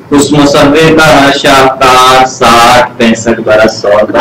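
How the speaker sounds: slow, measured recitation of verse; ghazal recitation. Acted. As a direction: lift the first line, settle the second. (دھیمی غزل خوانی)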